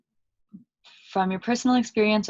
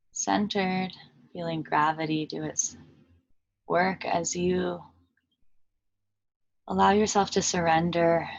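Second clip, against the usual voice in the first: calm meditation guide with long pauses between phrases; unhurried, even voice settling listeners into stillness; guided meditation; 4 dB quieter than usual. Acted. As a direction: lead them gently inward, leaving long silences.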